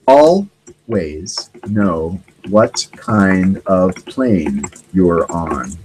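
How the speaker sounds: very slow, drawn out as if typing each word; typing dictation. Real, not acted. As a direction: speak at typing speed. Reading aloud while typing search.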